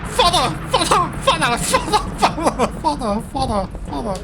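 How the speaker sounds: high, panicked cries for help; frightened son calling. Pretend. A scared son cries for his father's help in a high panicked voice. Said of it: "Why am I talking like this?".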